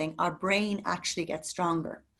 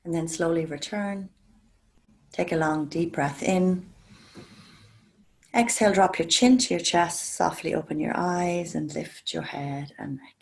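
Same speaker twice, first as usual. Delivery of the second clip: guided meditation. Acted. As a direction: ease the class into calm breathing, gently. Leads a guided breathing meditation.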